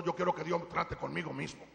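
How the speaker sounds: normal talking voice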